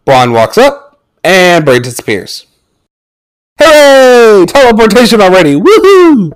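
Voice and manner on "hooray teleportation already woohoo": high, sarcastic celebration; mock cheering. Reviewer mocking a sudden teleportation, mock cheering. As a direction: whoop it up in mock delight.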